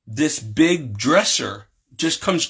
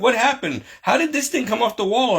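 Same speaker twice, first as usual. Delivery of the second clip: alarmed questioning; 8 semitones higher than usual. Retold or imitated. Parents question crying child.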